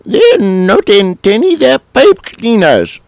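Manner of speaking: high, squeaky cartoon cat, puzzled. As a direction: squeak it out like a small confused cat. Cat figure protests.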